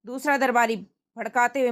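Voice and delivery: normal talking voice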